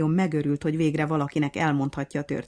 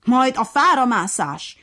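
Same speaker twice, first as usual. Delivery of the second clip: angry yell; 8 semitones higher, 9 dB louder than usual. A narrator's reading. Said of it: "Ordított mérgében".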